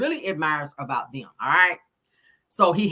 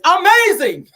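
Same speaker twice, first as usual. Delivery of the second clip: delighted praise; 13 semitones higher, 10 dB louder, slower than usual. Retold or imitated. Quoting the doctor's delighted reaction.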